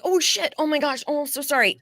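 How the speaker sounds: high, flustered "oh shit, so sorry"; panicked high-pitched apology; startled apology. Retold or imitated. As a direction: yelp it out like you just cut someone off. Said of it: "And you're like".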